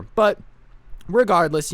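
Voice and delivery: ordinary delivery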